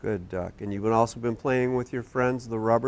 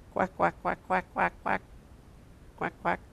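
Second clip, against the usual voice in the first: high, softer run of duck quacks; puppet duck quacking; 5 semitones higher, 6 dB quieter than usual. Pretend. Quacky the puppet saying goodbye, high duck quacks. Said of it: "say goodbye to everybody".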